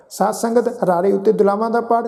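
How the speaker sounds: ordinary delivery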